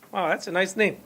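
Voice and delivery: ordinary delivery, nothing marked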